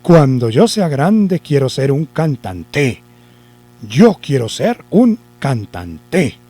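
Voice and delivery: "cantante" stressed on its last syllable, cantan-TÉ; very slow, land hard on TE in "cantante"; wrong stress on TE. Shown as a demonstration. Teacher tries the last syllable, stress on TE in "cantante". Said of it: "la vamos a correr".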